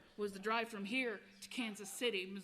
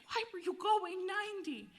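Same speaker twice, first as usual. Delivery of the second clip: high, drawn-out incredulous demand; louder, pitched-up scolding question; exasperated retelling; 9 semitones higher, 5 dB louder than usual. Retold or imitated. Bandmate questioning the speeding driver, exasperated.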